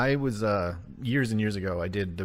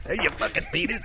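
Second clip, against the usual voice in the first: high, laughing mimicry of an outburst; laughing imitation; 12 semitones higher than usual. Amused viewer repeats the reader's outburst, laughing imitation. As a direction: repeat the outburst through giggles.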